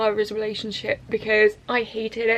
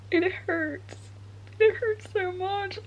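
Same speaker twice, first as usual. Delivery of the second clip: high, tearful voice; tearful hurt; 7 semitones higher, 4 dB quieter, slower than usual. Real, not acted. Reader hurting over a love story, tearful. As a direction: let the hurt pitch the voice up. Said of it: "Guys, I'm crying".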